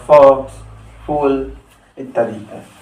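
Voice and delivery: usual speaking voice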